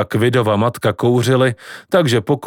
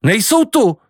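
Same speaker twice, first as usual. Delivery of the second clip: alarmed shout; 10 semitones higher than usual. A narrator's reading. Grandpa shouting about birds.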